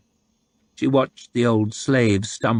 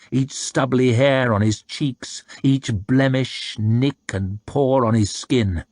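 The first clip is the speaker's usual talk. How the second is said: ordinary delivery, nothing marked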